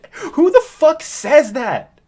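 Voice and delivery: loud, high, drawn-out disbelief; incredulous outburst. Real, not acted. Reviewer reacting to awful dialogue, incredulous shout.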